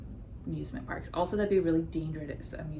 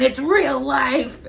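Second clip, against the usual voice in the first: higher, loud and drawn out; amazed exclamation; 7 semitones higher, 10 dB louder, much slower than usual. Real, not acted. Describing the game's amusement park to co-host, loud amazed exclamation.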